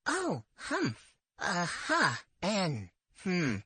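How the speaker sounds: encouraging hums to keep her talking; reassuring listening sounds. Shown as a demonstration. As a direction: murmur them like an attentive listener. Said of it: "make one of these reassuring sounds".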